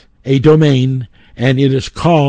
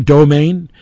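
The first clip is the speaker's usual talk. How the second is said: normal talking voice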